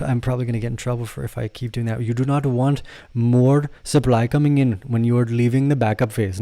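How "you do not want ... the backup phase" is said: put-on a mild Indian accent with a rising lilt. Host lecturing on supply, mildly in an Indian accent. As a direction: keep a light Indian lilt. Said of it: "From Southern to my Indian accent".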